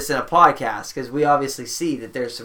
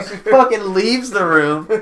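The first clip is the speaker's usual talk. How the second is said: higher, louder, slow amused commentary; mock play-by-play; 5 semitones higher, 5 dB louder, slower than usual. Podcaster narrating the cat leaving the room, mock play-by-play. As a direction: draw out the call like a commentator. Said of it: "running some play-by-play right there".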